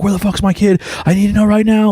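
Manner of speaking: mock a parent losing track of a kid; panicked helicopter parent. Helicopter parent missing their kid, high frantic voice. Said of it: "helicopter parents".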